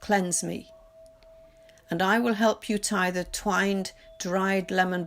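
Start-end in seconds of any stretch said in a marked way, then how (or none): none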